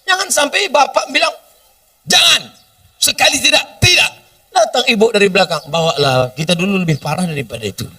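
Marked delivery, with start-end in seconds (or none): 2.1-4.1 a father's sharp, high no; firm refusal. Retold or imitated. Father firmly refusing the boy who asks for his daughter. 5.7-7.9 quick, soft, permissive aside; soft careless mother. Retold or imitated. Mother slipping in from behind to allow it, quickly and softly.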